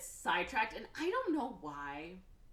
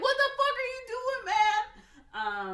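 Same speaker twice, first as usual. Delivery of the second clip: terrified shriek; 11 dB louder, much slower than usual. Retold or imitated. Timo dangling over the pit.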